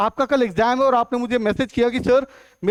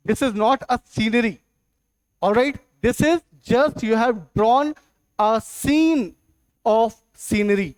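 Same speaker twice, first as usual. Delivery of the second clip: slow explanation; much slower than usual. Shown as a demonstration.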